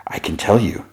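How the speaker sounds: whispered, secret and coaxing; eerie whisper. Retold or imitated. Mysterious voice whispering to Charles in a vision, eerie whisper. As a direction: breathe it like a voice in his head.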